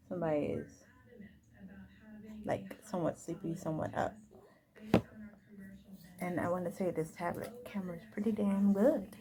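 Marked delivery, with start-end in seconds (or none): 0.1-8.0 soft unvoiced whisper. Real, not acted. Whispering near sleepy baby.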